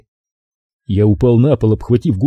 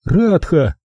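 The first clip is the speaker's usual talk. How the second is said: name given high with a fall; high, falling РАДХА as an answer; curt captive woman; 7 semitones higher than usual. A narrator's reading. She gives her name.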